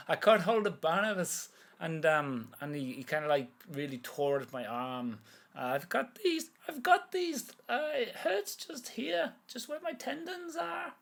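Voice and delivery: put-on high old Irish woman complaining; old woman Millie. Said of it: "I'm doing Millie".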